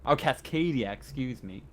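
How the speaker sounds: ordinary delivery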